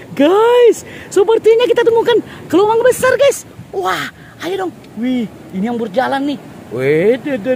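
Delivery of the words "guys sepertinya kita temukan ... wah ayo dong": much higher, eager and thrilled; excited find. Real, not acted. Vlogger tells viewers they found a big hermit crab, high excited voice. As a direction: announce the big find breathlessly.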